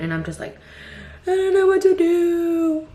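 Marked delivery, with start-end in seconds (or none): 1.3-2.9 high, loud, slow exasperated whine; helpless whine. Retold or imitated. Mother quoting herself to husband, helpless loud whine. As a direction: stretch it out like a worn-out mom at her limit.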